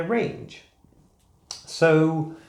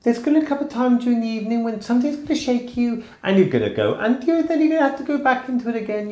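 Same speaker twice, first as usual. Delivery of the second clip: much higher put-on voice, fast on the long lines; high-pitched chipmunk voice; 8 semitones higher, 3 dB louder than usual. Shown as a demonstration. To viewers, demonstrating the unnatural high voice, pitched way up. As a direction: push the voice way up into a forced falsetto. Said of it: "the sort of animated chipmunk voices".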